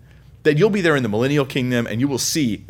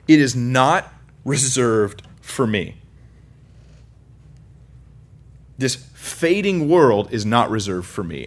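slow, weighty sermon line; slow emphatic declaration; slower than usual. Real, not acted. Preacher stressing that this world is not his, slow. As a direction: lay each word down slowly.

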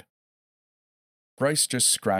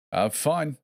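called out; 9 semitones higher than usual. A narrator's reading. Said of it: "he called out".

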